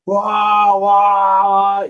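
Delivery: loud, high mock crying wail; imitated toddler wail. Imitating a toddler crying loudly while waiting at school. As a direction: copy a little girl's bawling.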